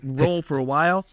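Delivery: ordinary delivery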